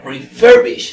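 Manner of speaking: "refurbish" with FER punched loud; stress on FER. Shown as a demonstration. Teacher shows where the force falls, stress on FER in "refurbish". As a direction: hit the middle syllable of "refurbish" hard. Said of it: "la mayor fuerza de voz está en fer".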